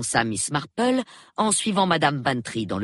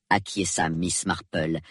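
usual speaking voice

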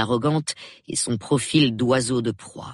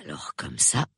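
unvoiced, much softer whisper; 9 semitones lower, 8 dB quieter than usual. A narrator's reading. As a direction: coax the boy in a hushed voice. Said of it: "chuchota le superintendant". (chuchoté, tout bas)

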